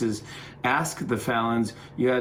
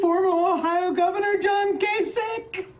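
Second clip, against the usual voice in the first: former Ohio Governor John Kasich squealed much higher; squealing kid; 3 dB louder than usual. Retold or imitated. Kids shriek the governor's name in high delight. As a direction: gush "John" like a starstruck kid. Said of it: "Kids were like".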